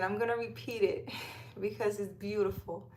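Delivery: normal talking voice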